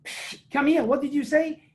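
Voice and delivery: high, sharp mock challenge; indignant referee. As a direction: call him back as an affronted referee. Referee calls the player back.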